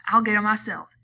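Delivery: loud, determined; mean it, ready to go; angry vow. A narrator's reading. Slim vowing to step in, angry. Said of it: "he cried".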